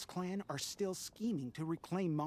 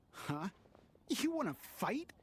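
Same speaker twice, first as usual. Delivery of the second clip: confrontational; 2 semitones higher than usual. Acted. Citizen confronts Eula angrily.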